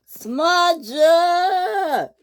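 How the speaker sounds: high, long sung-out scream of a name. A narrator's reading. Victor screaming at Smudger from the steamworks. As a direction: yell across the yard, stretching it. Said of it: "screamed Victor".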